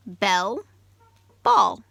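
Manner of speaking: "bell" and "ball" with the final L stretched; sounding out final sounds. Shown as a demonstration. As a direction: lean on the L at the end of "ball". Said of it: "They end in the same sound".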